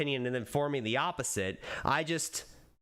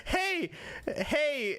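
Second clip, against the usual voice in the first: calling out; 11 semitones higher than usual. Real, not acted. Calling out to the community.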